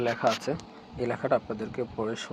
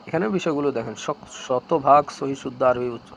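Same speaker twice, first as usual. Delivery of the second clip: ordinary talk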